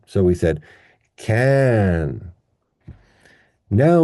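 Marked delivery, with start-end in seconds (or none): 1.2-2.3 "can" long and drawn out with a full vowel; stressed full vowel. Shown as a demonstration. Teacher showing the stressed syllable, "can" long and full. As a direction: stretch "can" out clearly. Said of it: "with full vowel pronunciation".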